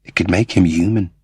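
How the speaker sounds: plain reading voice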